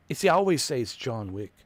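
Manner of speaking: ordinary talk, nothing marked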